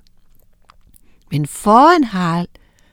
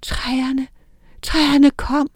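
whisper; 5 semitones higher, much slower than usual. A narrator's reading. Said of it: "hviskede Gyda".

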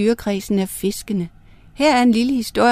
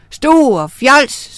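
louder, higher scolding cry; scolding shriek; 4 semitones higher, 6 dB louder than usual. A narrator's reading. Wife scolding her husband for wishing nothing, shrieking. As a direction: shriek it as an angry telling-off. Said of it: "skreg hun".

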